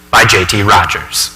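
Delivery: ordinary talk, nothing marked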